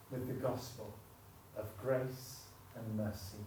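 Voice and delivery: gentle pastoral prayer voice. Acted. Leads closing prayer.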